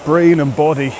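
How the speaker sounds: fit a few words between each stroke, pausing for breath; talking while rowing. Real, not acted. Coach explains rhythm to rowers mid-interval, talking through exertion.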